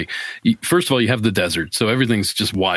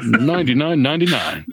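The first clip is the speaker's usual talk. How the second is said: infomercial; 4 semitones higher than usual. Pretend. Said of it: "joking about rent to own".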